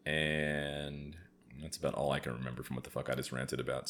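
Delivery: ordinary talk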